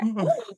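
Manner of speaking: a high, sharp staged gasp of fright; mock horror gasp.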